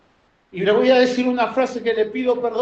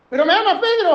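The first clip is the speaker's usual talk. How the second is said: Jesus insisting; 8 semitones higher, 4 dB louder, faster than usual. Acted. Jesus asking again.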